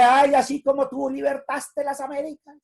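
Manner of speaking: sarcastic mock supplication; mock pleading to a statue. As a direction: ridicule prayer to a bust. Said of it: "Dígale".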